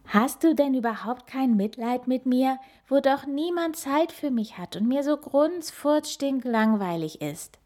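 put-on high whiny nagging child; pestering child. A narrator's reading. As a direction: nag like a sulking little kid. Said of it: "quengelt der kleine Nasenbär".

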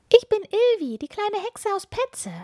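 put-on very high, bright child voice; little witch girl. A narrator's reading. Little witch Ilvi introducing herself to the hermit, high child voice. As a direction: play a small eager witch girl.

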